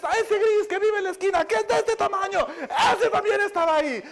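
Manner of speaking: relato agudo que crece hasta el grito; escandalizada. Retold or imitated. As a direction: imita una indignación histérica, fuerte y aguda. Esposa relata el hallazgo, escandalizada. (escandalizada, subiendo hasta gritar)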